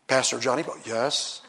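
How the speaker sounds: low, soft, mock-solemn voice; hushed, low pastoral response; dignified pastor reply. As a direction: imitate a composed minister answering. Acts out being addressed as pastor.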